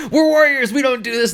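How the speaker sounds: loud, high comic outrage; imitated offended warriors, laughter just behind it; mock indignation. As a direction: protest at the top of your voice, hammed-up. Retold indignant refusal by Decepticons.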